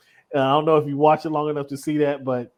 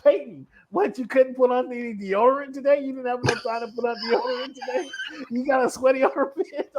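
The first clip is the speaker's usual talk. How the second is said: high, laughing teasing voice; laughing mock Eli; 7 semitones higher than usual. Eli laughing as he teases Peyton about deodorant. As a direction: tease him through laughter. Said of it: "Eli's like".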